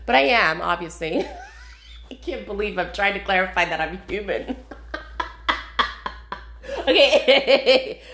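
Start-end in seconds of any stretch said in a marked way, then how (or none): 4.6-8.1 real laughter. Real, not acted. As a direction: break up laughing, then recover. Laughs at clarifying she's human.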